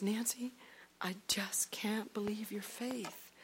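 slow, soft whispered awe; choked-up whisper. Retold or imitated. Husband crying on the phone to his wife, whispered. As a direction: whisper it, moved to tears. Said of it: "he just started crying on the phone".

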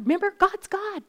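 usual speaking voice

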